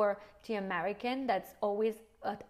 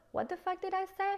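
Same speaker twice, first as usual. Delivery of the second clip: mock horrified squeak; 11 semitones higher, faster than usual. Cringing at own podcast.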